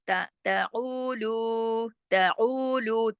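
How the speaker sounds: slow ताउलू, short ta and long oo, loo; throaty ain in ताउलू, both later vowels stretched; double elongation. Shown as a demonstration. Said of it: "ऊ और लू दोनों दो दो हरकत खींचेंगे". (ताउलू में ऊ और लू खींचकर)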